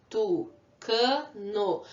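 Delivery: "Tucano" very slow, tu, ca, no; teacher sounding out "Tucano" in three beats; syllable by syllable. Shown as a demonstration. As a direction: leave a gap after each syllable of "Tucano". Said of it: "Quantas vezes eu abri a boca".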